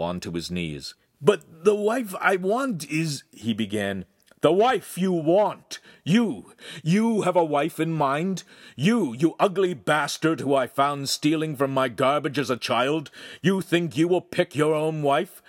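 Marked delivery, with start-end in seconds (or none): 1.2-3.2 high, slow pleading apprentice voice; timid young apprentice. A narrator's reading. Apprentice begging his master, high and slow. 4.4-15.4 furious master. A narrator's reading. Master berating his apprentice, loud and furious.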